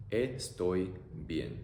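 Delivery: very slow "Estoy bien." syllable by syllable; teacher modelling slowly; slow demo. Shown as a demonstration.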